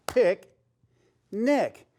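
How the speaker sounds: "Pick, nick" as two separate beats; clapped syllables. Shown as a demonstration. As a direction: split into two beats with a pause.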